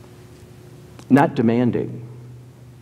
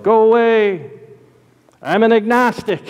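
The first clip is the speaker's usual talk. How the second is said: higher-pitched imitated yell through a door; mock yell; 7 semitones higher than usual. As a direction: imitate a man yelling at a caller. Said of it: "the guy on the inside yelled".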